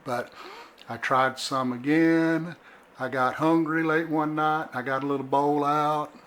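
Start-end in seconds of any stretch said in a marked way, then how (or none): none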